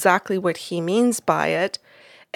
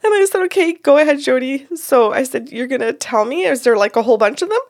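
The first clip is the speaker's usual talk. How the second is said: laughing; 6 semitones higher, 5 dB louder, faster than usual. Real, not acted. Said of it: "I had such a good laugh right there".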